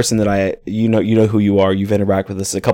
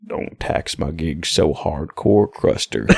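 low, slow, soft slacker impression; Stoney lingo; 5 semitones lower, 5 dB quieter, slower than usual. Showing off the film's lingo, slow stoner impression. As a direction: drawl it out low, fading to a voiceless finish. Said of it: "just the, the entire lingo".

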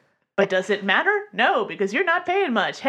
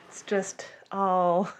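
normal talking voice

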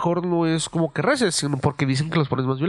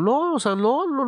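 higher-pitched retold denial; voicing the grandmother; 9 semitones higher, slower than usual. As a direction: insist no in a raised, lightly voiced tone. Grandmother denying in retold dialogue.

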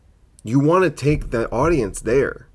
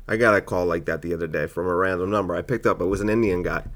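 ordinary delivery

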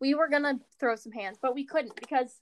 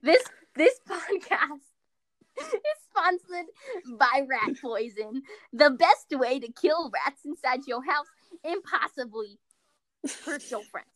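laughing mock ad read; 3 dB louder, slower than usual.

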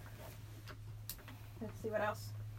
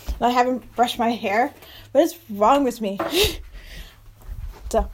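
ordinary talk, nothing marked

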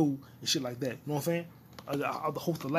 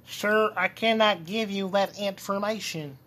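high, slow, prim service voice; polite refusal, lightly voiced; mock clerk voice; 4 semitones higher, 3 dB louder, slower than usual. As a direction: play a stiff customer rep.

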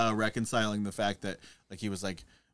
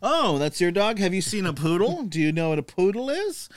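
snobby boast; 7 semitones higher than usual. Retold or imitated. Acted snobby dog owner.

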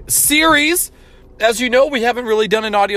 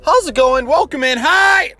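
hyped greeting; 13 semitones higher than usual. Real, not acted. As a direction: burst in greeting the listeners. Podcast host's hype opening greeting.

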